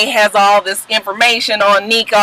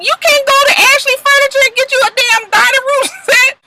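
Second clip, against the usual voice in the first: incredulous. Real, not acted. Exasperated jab at cheap set.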